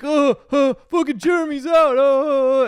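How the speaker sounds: very high, loud shout; high whooping jeer; excited kids cheering. Acted. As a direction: cheer like schoolkids.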